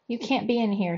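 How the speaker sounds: plain reading voice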